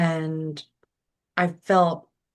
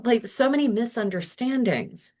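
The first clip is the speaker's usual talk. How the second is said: ordinary talk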